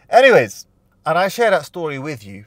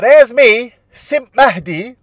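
high, loud, drawn-out self-mockery; mock self-roast; 11 semitones higher, 13 dB louder, much slower than usual. Real, not acted. Host introducing his lovestruck younger self, high and loud. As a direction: announce your foolish younger self.

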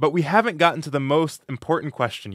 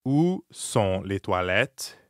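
slow, low, word-by-word French question; slow model phrase; 5 semitones lower, 3 dB quieter, slower than usual. Shown as a demonstration. Teacher models the bathroom question for beginners, slowly. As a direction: space out each word for a beginner, pitched low.

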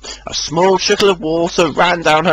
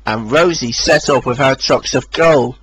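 plain reading voice

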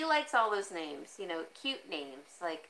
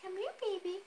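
coaxing a kitten; 11 semitones higher, 3 dB quieter, much faster than usual. Retold or imitated. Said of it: "And I'm like".

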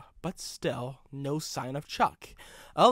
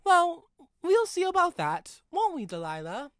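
much higher, louder, playful; teasing Chuck; 14 semitones higher, 5 dB louder than usual. A narrator's reading. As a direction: tease the engine. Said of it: "asked Chuck".

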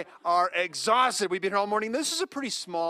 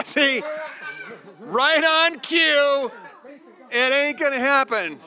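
excited teasing; 9 semitones higher, 4 dB louder, much slower than usual. Real, not acted. Teasing a toddler.

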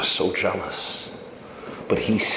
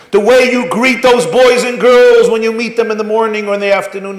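preaching; 12 semitones higher, 8 dB louder than usual. Real, not acted. Rabbi telling parents his story, preaching loud. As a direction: drive it home to the crowd.

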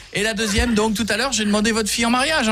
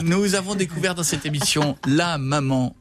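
ordinary talk, nothing marked

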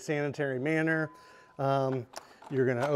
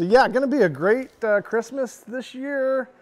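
real excitement, pitch lifted higher, louder at the start; excited; 7 semitones higher, 4 dB louder than usual. Real, not acted. Host wrapping up his holiday gift list, excited. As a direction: wrap it up buzzing about the holidays. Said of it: "I'm really excited about the holiday season".

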